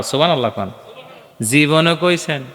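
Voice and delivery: ordinary delivery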